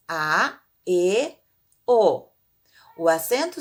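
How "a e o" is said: "A, E, O" as separate open vowels; isolated open vowels "A, E, O"; open vowel sounds. Shown as a demonstration. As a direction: say "A, E, O" one by one, mouth open wide. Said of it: "indica o som aberto das vogais".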